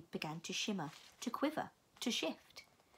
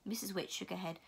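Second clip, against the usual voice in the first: ordinary delivery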